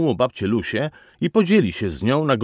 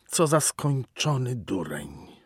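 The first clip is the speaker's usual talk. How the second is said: slow, whispered thought; hushed, drawn-out inner voice; whispered self-reproach; 2 semitones higher, 5 dB quieter, slower than usual. A narrator's reading.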